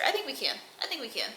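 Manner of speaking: hushed hope. Real, not acted. Asking viewers for subscribers, hushed. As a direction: half-whisper it hopefully. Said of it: "hushed hopeful repeat".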